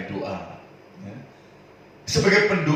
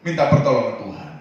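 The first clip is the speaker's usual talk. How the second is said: ordinary talk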